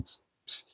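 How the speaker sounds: soft hissed imitation of spitting oil; spitting oil noise. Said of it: "it was spitting".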